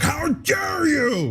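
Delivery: high, stretched-out indignant shriek; way up high and slow, hammed-up outrage; mock outraged shriek. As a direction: play the scandalized host, all pearl-clutching. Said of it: "they were like".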